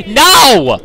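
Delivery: loud, high, drawn-out cry; shouted dismay. Real, not acted. Player watching his sneak attack fail, shouted dismay. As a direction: yell it as the plan falls apart. Stealth attempt fails.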